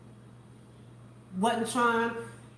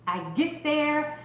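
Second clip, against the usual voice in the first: usual speaking voice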